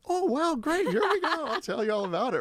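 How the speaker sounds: high, loud, delighted rush of words; eager gush. Retold or imitated. Guest to podcast host, thrilled to be asked, gushing. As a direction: burst out happily with a laugh. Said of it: "the other person is like".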